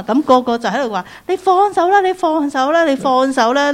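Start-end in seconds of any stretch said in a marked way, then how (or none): 1.3-3.7 loud, high monkey chatter; monkey talk. Retold or imitated. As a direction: urge it like excited monkeys. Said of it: "啲馬騮識講馬騮話㗎嘛".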